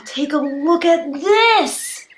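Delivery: slow, loud, high wonder; playacted thrilled discovery; pretend amazement. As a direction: stretch it out in hammed-up awe. Pretend amazement at animal tracks.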